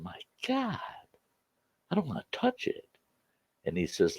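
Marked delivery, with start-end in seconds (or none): 0.0-2.9 hushed, higher-pitched retold awe; awed reluctance. Player facing an heirloom banjo, awed and reluctant. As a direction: recoil softly, as if it's too precious.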